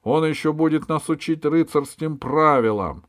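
high, loud indignation; angry. A narrator's reading. Valet resents being taught knightly rules, angry. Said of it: "озлился камердер".

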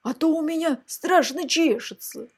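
high put-on itchy dragon; storybook dragon pleading to be scratched; demanding dragon head. A narrator's reading. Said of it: "потребовала шестая".

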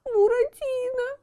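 high tearful frightened call; frightened crying. Acted. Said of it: "пугливо оглядываясь". (испуганный плач Пьеро)

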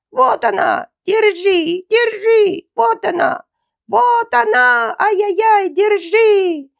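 very high, loud cub shrieks; frantic squealing. A narrator's reading. Bear cub spots the girl, shrieking squeal. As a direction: squeal as if being slaughtered. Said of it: "завизжал так, как будто его режут".

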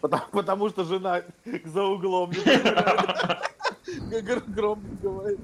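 laughing through the words; chuckling while explaining. Real, not acted. Laughing over wife's loud talk.

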